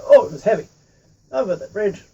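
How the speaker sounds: ordinary delivery, nothing marked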